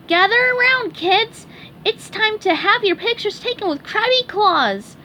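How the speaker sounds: put-on high, loud cheery call to kids; announcing SpongeBob. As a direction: announce it to the crowd. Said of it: "he announced".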